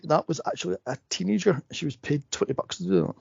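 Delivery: ordinary talk, nothing marked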